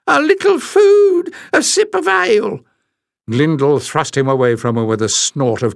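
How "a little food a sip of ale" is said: very high, pitiful, piping plea; quavering beggar. Acted. Small stranger begging the newcomer: a very high quavering plea. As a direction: whimper it up high like a starving wretch. Same beggar continues pleading.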